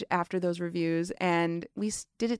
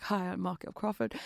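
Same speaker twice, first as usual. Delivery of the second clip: quieter, badly faked English accent; Michael Crawford; 7 dB quieter than usual. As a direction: imitate a British introduction with a clumsy accent. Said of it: "that's horrible accent".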